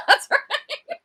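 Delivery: high squeaky laugh through words; laughing while agreeing. Real, not acted.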